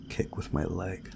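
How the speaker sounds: normal talking voice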